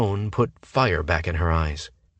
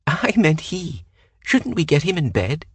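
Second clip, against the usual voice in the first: 1 airhead coo; 10 semitones higher, 4 dB louder than usual. A narrator's reading. Flirty woman corrects herself.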